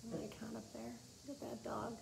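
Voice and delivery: hushed, half to herself over the picture; soft aside. Real, not acted. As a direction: murmur it while showing the page.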